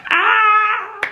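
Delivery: high, loud drawn-out scream. Retold or imitated. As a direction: let out a re-enacted scream of collapse. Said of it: "I just screamed".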